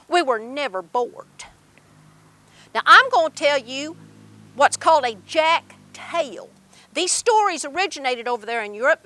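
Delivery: ordinary delivery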